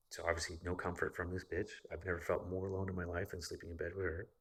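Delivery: usual speaking voice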